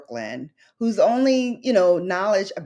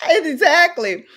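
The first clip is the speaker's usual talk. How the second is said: laughing through tears; 13 semitones higher, 7 dB louder than usual. Real, not acted. Laughing, tearful over memory.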